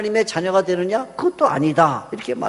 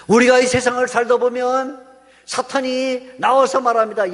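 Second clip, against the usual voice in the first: ordinary talk, nothing marked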